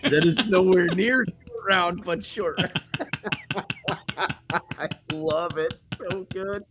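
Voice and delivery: high-pitched, amused talk breaking into laughter; real tickled laughter; laughing. Real, not acted. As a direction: laugh through the words, pitched well up. Laughing at the Short Round remark.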